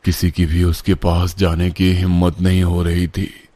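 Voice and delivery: ordinary delivery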